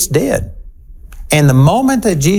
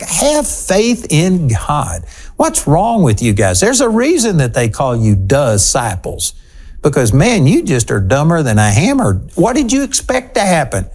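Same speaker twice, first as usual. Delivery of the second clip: incredulous rebuke. Acted. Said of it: "Like, why are you surprised".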